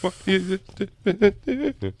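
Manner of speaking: high, slow, broken-up fake weeping; mock crying. Actor to mom in the scene, trying to cry, hammed-up weeping. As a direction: struggle through the words as if sobbing. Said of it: "find some sad shit so I can cry".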